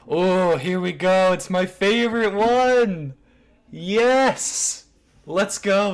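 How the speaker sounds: loud, high, drawn-out delight; gleeful excitement. Real, not acted. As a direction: crow with glee as your favorite comes up. Delighted at reaching his favourite screenshot.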